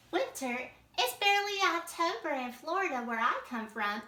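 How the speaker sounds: can't believe it's winter already; surprised alligator. Acted. Teacher voices Alvaro reading drama.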